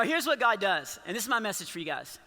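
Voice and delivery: ordinary delivery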